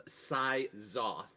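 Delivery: slowly spells out Sai Zoth, syllable by syllable; slow, careful name. Shown as a demonstration. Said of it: "I'm, like, saying it sl".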